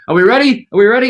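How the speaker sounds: impatient backstage crew checking in; rushing stage manager. Retold or imitated. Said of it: "a guy with a clipboard ... going".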